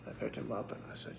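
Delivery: usual speaking voice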